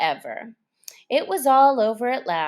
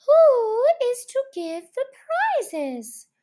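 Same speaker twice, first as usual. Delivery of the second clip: much higher, louder squeaky question; chorus of voices; 5 dB louder than usual. A narrator's reading. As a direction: pipe up like many small creatures.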